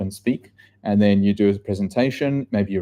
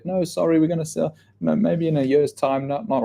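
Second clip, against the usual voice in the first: higher, hurried excuse-making vendor voice; mock evasive brush-off; 7 semitones higher than usual. Vendor lying to a door-knocking agent, lightly mimicked brush-off. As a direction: imitate someone fibbing to get rid of an agent.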